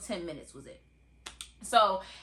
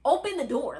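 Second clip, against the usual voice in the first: loud, higher, exasperated demand; retold angry outburst; 3 semitones higher, 7 dB louder than usual. Dad yelling at the security guard to open the door. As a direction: push the guard hard, voice raised. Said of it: "My dad is flipping out on this man".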